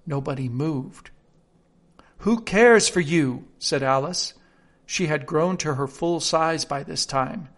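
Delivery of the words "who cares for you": defiant. Acted. Alice defies the court.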